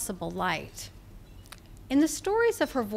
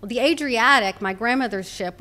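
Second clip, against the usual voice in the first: usual speaking voice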